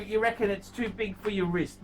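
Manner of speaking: usual speaking voice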